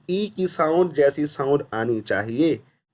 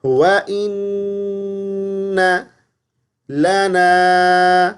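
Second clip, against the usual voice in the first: slow, higher melodic tajweed recitation; Quran recitation; 3 semitones higher, much slower than usual. Acted. Teacher recites the verse for students, melodic tajweed. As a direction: recite slowly with a drawn-out tune.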